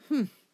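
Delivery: high, loud disapproving humph; a huffy harrumph from the elder; grumbling humph. A narrator's reading. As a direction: scoff loudly through the nose. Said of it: "Lungwort murmured a".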